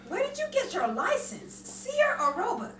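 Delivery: high, loud indignant scolding; indignant passenger. Acted. To the driver in the car scene, loud indignant scolding.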